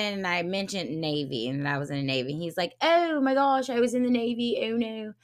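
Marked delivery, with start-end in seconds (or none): 2.8-5.1 imitating a surprised man in a British accent. Retelling his surprise at meeting a Navy veteran, British accent. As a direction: put on a mock British voice. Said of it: "I'm doing a British accent".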